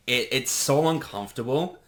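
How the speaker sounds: normal talking voice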